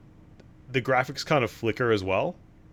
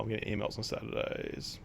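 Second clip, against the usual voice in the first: usual speaking voice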